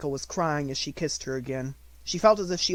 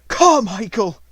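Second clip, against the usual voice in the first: higher, much louder desperate call; loud cry; 8 semitones higher, 13 dB louder, slower than usual. A narrator's reading. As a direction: cry the name out loudly. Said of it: "cried out loudly".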